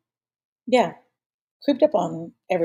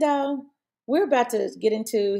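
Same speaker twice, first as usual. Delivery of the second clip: ordinary talk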